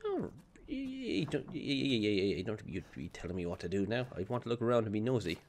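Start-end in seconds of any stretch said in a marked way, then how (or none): none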